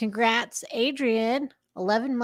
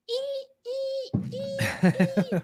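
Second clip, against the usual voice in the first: squeaky wiper noise; slower than usual. Pretend. Imitating squeaky windshield wipers.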